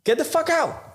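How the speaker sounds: high mock snarl; rude brush-off. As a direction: imitate her rude dismissal. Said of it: "they're like".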